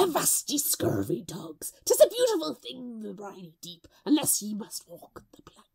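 put-on old sailor. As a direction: growl it like an old sea dog. Reading a pirate-voiced comment.

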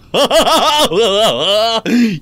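high, rapid cackling laugh; loud high giggling cackle; burst of laughter. Real, not acted. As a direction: let out a long high cackle. Gamer's own burst of laughter.

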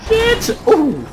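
high loud yelp; startled yelp. Real, not acted. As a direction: cry out in shock. Sudden crash.

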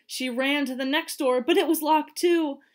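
building panic. A narrator's reading. Narrator building panic at locked doors.

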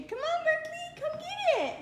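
high, loud sing-song dog call; calling the dog for her toddler. Shown as a demonstration. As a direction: coax the dog like a toddler would.

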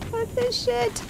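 high, soft exasperated squeak; fed-up squeak. Real, not acted. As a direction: squeak it out, done with this. Giving up after scare.

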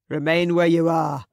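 loud, booming order to halt; booming command. A narrator's reading. Man in the brush ordering the party to halt, booming. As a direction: boom it out from afar. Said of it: "boomed a man".

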